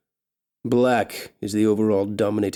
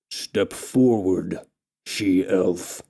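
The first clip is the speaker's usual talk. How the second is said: low, slow commanding orc; 3 semitones lower, much slower than usual. Acted. Orc king commanding the elf, low and slow. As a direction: lower and slower, a brute giving orders. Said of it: "the orc leader commands".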